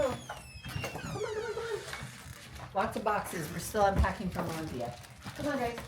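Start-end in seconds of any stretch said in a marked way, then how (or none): 0.0-1.8 very high, soft sing-song call; calling dogs. Real, not acted. Owner calls the dogs indoors, high coaxing call. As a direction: coax the dogs in with a high lilt.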